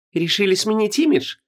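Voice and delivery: high, sarcastic, snide question. Acted. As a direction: needle her with it. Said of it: "едко поинтересовалась Вон".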